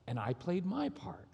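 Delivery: usual speaking voice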